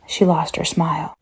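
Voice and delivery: plain reading voice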